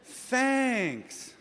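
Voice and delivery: high, deflated all right, thanks; flat forced thanks. Retold or imitated. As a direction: thank them while hiding the letdown. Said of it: "you're like".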